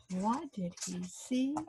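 soft, slow secret question; hushed question. A narrator's reading. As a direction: lean in and ask softly. Asking children quietly.

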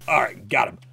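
ordinary delivery

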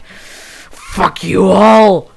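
loud, drawn-out mock dragon yell; raging dragons. Player voicing the dragons attacking everyone, mock rage. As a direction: bellow it slowly as an angry dragon. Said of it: "Those dragons were like".

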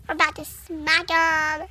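ordinary talk, nothing marked

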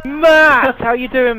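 high, loud happy hello; excited greeting. Real, not acted. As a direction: call out a friend's name. Greeting newcomer loudly.

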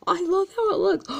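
high, gasping delight over the card; excited gush. Real, not acted. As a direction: squeal with real joy, pitched high.

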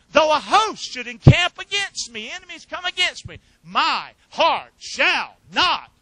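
high, loud, slowed on not and fear; fervent psalm declaiming. Acted. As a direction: proclaim each line with rising conviction.

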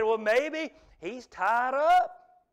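slow, drawn-out mocking question; a sneering mock of a useless idol; sarcastic taunt. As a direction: needle them with fake concern. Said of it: "So there's the sarcasm".

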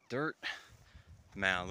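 ordinary talk

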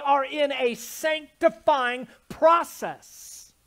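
loud, rising preacher's cry, slowed on key words; preaching at full force. Acted. Pastor preaching to husbands at full force. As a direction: build the sermon to a shouting climax.

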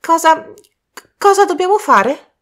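strangled high, loud stammer; acted choking on suppressed laughter; choked voice. A narrator's reading. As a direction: force it out high through a choked throat. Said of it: "Con voce strozzata Michael riuscì a dire".

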